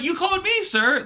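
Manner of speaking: high-pitched, laughing protest; exasperated politeness. Retold or imitated. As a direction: protest helplessly to a customer. Exasperated reply to caller, acted.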